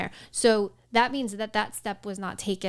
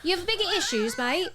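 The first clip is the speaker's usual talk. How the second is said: higher, hammed-up English accent; put-on English accent, pitched up; 8 semitones higher than usual. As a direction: repeat it as a mock English mate. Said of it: "doing it in an English accent".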